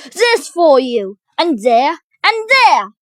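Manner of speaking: loud, punchy, climbing to a high yell; fighting cry. A narrator's reading. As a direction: cry out with each blow. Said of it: "he cried".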